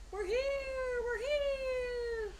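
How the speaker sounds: high, loud fearful cry. Acted. The Whos crying out to Horton, high and afraid. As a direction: call out desperately to be heard. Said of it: "cried out with fear".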